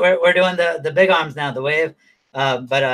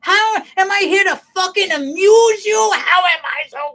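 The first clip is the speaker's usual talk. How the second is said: high-pitched, hammed-up gangster tirade; mock furious; 14 semitones higher than usual. Gangster confronting Henry over being called funny, mock furious. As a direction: shout it like an offended movie mobster.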